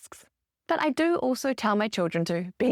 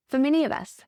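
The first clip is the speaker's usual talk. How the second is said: ordinary delivery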